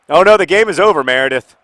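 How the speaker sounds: loud, high cry of alarm; alarmed outburst. Real, not acted. Host panicking at his football game, loud alarmed cry. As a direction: yelp as the game slips away. Reacting to video game match.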